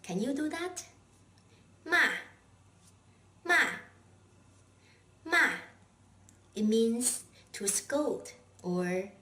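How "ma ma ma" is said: each MA short, sharply falling; fourth tone. Shown as a demonstration. Teacher drills the fourth tone, MA falling sharply. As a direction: throw every MA down from high pitch. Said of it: "with a sharply falling tone".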